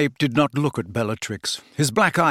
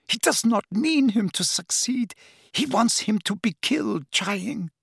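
1 distressed; 8 semitones higher than usual. A narrator's reading. Narcissa desperate about her son.

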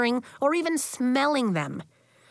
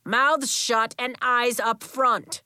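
commanding; 3 semitones higher, slower than usual. Acted. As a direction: bark it slowly to the class. Coach commanding the kids.

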